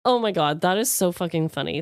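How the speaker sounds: normal talking voice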